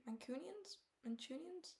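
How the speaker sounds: "Mancunians" tried out with a questioning rise; checking the word "Mancunians"; unsure questioning. Real, not acted. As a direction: test the word "Mancunians" as if checking it's right. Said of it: "Manchester, Manchu".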